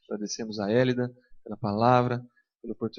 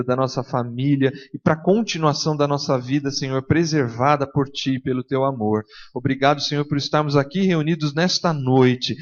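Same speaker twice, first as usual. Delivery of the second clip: fervent prayer voice, a bit louder and fuller; closing prayer; 3 semitones higher, 6 dB louder than usual. Acted. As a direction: pray it over the congregation with conviction. Pastor leads closing prayer. (oração fervorosa em voz mais cheia)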